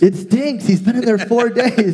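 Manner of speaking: high, hammed-up complaint breaking into laughter; mock protest. Onlookers warning Jesus about the smell, mock protest.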